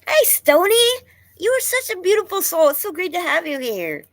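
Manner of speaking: high, loud, sing-song hello; bright high welcome; excited greeting. Real, not acted. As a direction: greet a friend who just arrived. Greeting a viewer warmly.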